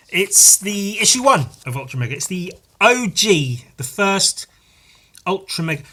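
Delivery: high, loud proud announcement; high proud excitement. Real, not acted. Showing viewers the first issue, high and proud. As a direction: show off the treasure.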